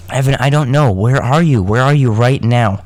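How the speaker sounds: agitated, insistent acted outburst; alarmed demand. A narrator's reading. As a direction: press for answers, rattled. Alarmed character on the phone.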